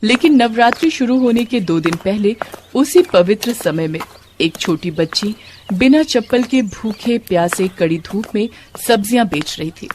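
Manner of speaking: usual speaking voice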